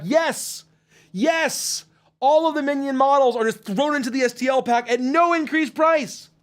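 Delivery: high, triumphant sales-pitch hype; emphatic excited yes. Real, not acted. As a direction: sell the great news hard. Triumphant answer to backer question.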